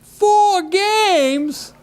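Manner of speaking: high-pitched incredulous yelp; acted squawk of disbelief, drawn out; outraged yell. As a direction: cry out like a husband aghast at the headline. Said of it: "and he yells out".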